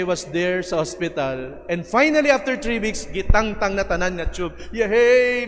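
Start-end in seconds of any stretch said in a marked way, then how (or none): 4.7-5.5 cheer. Retold or imitated. Happy to go home.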